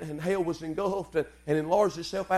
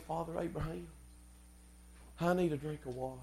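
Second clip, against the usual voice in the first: casual, untroubled request; 15 dB quieter than usual. Shown as a demonstration. Preacher showing how the rich man didn't plead, soft casual request. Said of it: "He didn't say".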